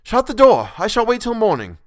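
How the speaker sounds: a cornered man yelling to shut the door; desperate cry. Acted. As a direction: cry out in panic to stop him. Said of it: "I cried out".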